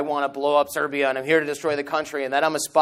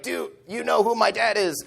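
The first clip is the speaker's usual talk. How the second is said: beaten friend pleading; 6 semitones higher than usual. Retold or imitated. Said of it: "between punches, be like".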